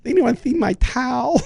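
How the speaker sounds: put-on high lisping boy voice again; lisping kid voice. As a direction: repeat the lisp bit. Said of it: "a little lisp that he goes".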